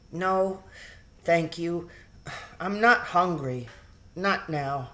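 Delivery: barely bothering to answer; tired sick boy. A narrator's reading. Weak Timothy.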